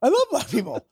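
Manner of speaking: high-pitched mock panic; acted protest. Retold panicked denial of accusation.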